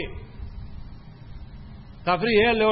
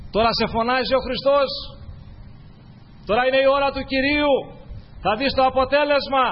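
urging; 7 semitones higher than usual. Acted. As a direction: rouse the blind man, the Lord is calling now. Crowd urging blind man, Christ calls.

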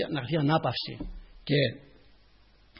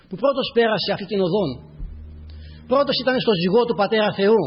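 raised, emphatic sermon voice; impassioned preaching; 5 semitones higher, 6 dB louder than usual. Real, not acted. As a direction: preach it out with rising fervour.

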